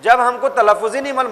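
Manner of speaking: normal talking voice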